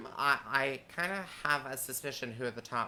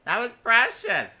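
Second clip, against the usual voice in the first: high, loud gushing delivery; excited; 9 semitones higher, 11 dB louder than usual. Retold or imitated. Quoting a queen's line.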